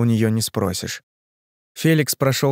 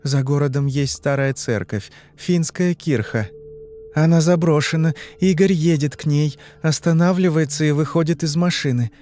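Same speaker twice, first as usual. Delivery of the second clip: put-on higher-pitched girl answering questions; young woman in trance; 5 semitones higher than usual. Girl answering the hypnotist's questions, higher entranced voice.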